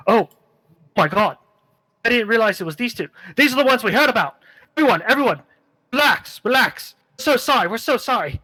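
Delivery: high, fast, flustered Irish lad; young outlaw panicking and apologizing; nervous teen bandit calming his gang. Acted. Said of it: "you can tell he's a little nervous".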